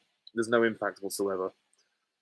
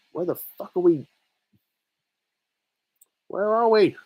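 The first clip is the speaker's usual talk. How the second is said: very high character voice; put-on high voice; 10 semitones higher, 4 dB louder than usual. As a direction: pitch it way up like a bewildered cartoon figure. High put-on voice, unnamed quote.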